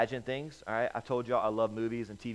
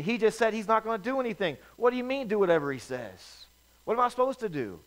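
high, disbelieving servant voice; incredulous protest; 9 semitones higher, 4 dB louder than usual. Acted. Servant doubting the mother's strange instruction, incredulous and high.

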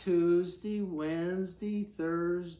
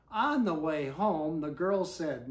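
ordinary delivery

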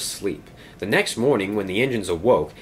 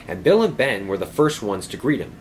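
usual speaking voice

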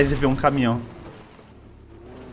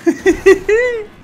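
excited yelps while dodging; being chased; much slower than usual. Real, not acted. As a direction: cry out as trucks close in.

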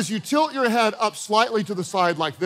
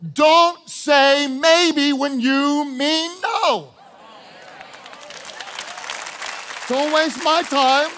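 forceful preaching; 4 semitones higher, much slower than usual. Real, not acted. Preacher exhorting the congregation not to waste time. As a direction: drive each word home slowly.

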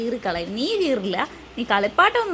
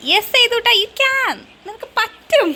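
high, loud encouragement; 9 semitones higher, 5 dB louder, slower than usual. Real, not acted. Mother urging baby to keep crawling, excited cheering. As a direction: urge a little one on, high and eager.

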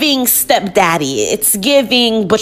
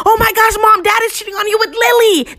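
retold scream; 10 semitones higher than usual. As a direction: blurt it out in a high imitation shriek. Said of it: "I screamed".

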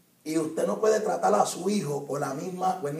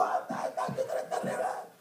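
teasing youths; 8 dB quieter than usual. Retold or imitated.

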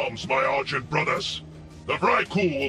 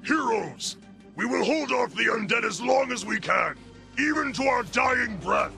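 high-pitched heroic battle call; rally the heroes for a last stand; rallying cry; 9 semitones higher than usual. Acted. Commander rallies heroes against undead.

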